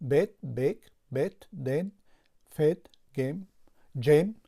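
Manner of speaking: short clipped e in "بت" and "بک", each word said loudly; teacher contrasting short e words, loud and distinct; short e vowel demo. Shown as a demonstration. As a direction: keep the e in "دن" and "گم" short as in bet. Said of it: "اما در اینجاها اگر ببینید".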